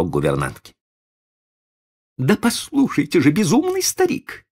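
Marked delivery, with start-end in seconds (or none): 2.2-4.5 high, strained insistence; insisting lady. Acted. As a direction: press him hard like a frantic lady. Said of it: "настаивала дама".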